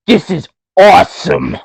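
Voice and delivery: put-on loud, high thirteen-year-old's excitement; Chris Sabin. Wrestler turning to his partner, mimicked teenage glee. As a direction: exclaim it like a thrilled young teen. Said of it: "Like he's thirteen".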